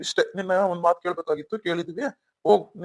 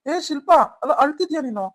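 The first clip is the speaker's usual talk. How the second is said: startled uncle; 7 semitones higher, 4 dB louder, slower than usual. Acted. Uncle startled she is crying.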